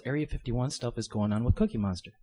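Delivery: ordinary talk, nothing marked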